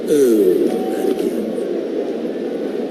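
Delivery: mock umpire groan. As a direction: imitate the umpire's weary disbelief. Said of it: "Will Little going".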